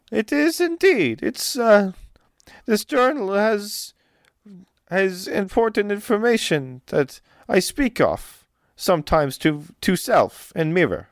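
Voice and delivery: high, dramatic voice with broken English; fretful noblewoman. Acted. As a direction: wring your hands over the poisoning. GM voices Olga.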